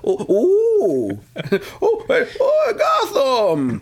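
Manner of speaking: hammed-up theatrical villain; inquisitive butler. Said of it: "he was always kind of inquisitive".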